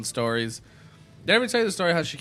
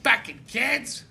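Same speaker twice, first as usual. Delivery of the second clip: higher, slow indignant drawl; drunk mom impression; 7 semitones higher than usual. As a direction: play the outraged tipsy mom. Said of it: "She's like, she's like".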